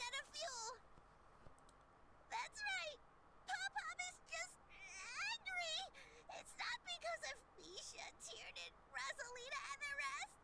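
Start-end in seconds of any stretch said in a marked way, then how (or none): 2.3-10.4 tearful. Acted. Crying, insisting they're angry.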